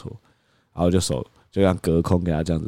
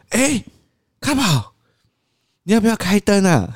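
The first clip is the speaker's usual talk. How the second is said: much higher, sing-song coaxing; coaxing toddler; 12 semitones higher than usual. Acted. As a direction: lure the child with a bright question. 父亲哄孩子的语气. (高音哄小孩的语气)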